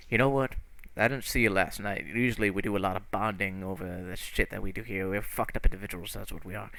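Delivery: low, soft, man-to-man character talk; lightly acted heart-to-heart, hesitant and low; confiding character. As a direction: confide respectfully, keeping it down.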